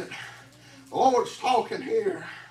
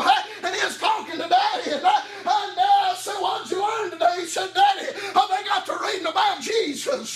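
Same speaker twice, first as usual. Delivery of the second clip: chanted whooping sermon, a sharp huh after each line; preaching at full force; 5 semitones higher than usual. Acted. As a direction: drive each phrase up and bark a huh at the end.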